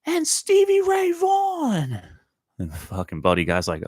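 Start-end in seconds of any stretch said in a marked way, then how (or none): 0.1-2.0 put-on high, slow drunken shriek of a name; slurred, stretched heckler's holler; drunk scream. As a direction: yell it across the hall like a tipsy fan. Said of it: "some drunk lady in the crowd screams out".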